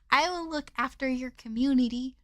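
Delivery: usual speaking voice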